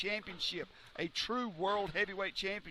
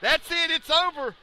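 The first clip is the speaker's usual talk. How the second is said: yelling the finish, much higher and louder; excited shout; 9 semitones higher, 10 dB louder than usual. Real, not acted. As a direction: scream it like the match just ended. Calling the finish.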